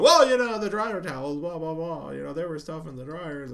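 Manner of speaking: high, loud mock cover-up chatter; very loud talking. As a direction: imitate someone loudly changing the subject to drown out a conversation. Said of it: "was talking very loud about dryer towels".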